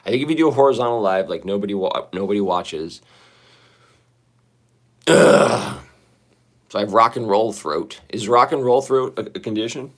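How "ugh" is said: loud throaty "ugh"; loud wordless groan of discomfort. Real, not acted. Groans alone before complaining of throat.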